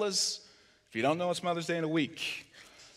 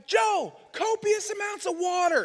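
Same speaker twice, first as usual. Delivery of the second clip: urgent shout; 11 semitones higher than usual. Retold or imitated. Yelling instructions to wife.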